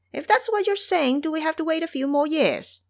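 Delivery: high, eager little imp; squeaky imp voice. Acted. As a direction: pitch way up like a nervous minion. Imps ask their monster king.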